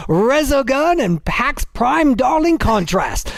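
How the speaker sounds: put-on Australian accent, lively read; crocodile hunter voice. Host reads listener's battle cry, mock Australian accent. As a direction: read it out as a hammed-up Aussie. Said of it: "my crocodile hunting voice".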